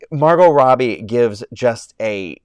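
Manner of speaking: usual speaking voice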